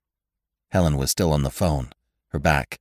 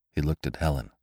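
ordinary delivery

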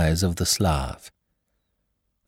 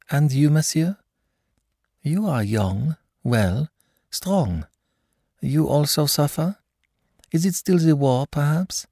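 higher, solicitous foreign mother; concerned question; 8 semitones higher than usual. Acted. Russian mother asking Lord Peter about his suffering, pitched up and kind. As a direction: ask him kindly, pitched up.

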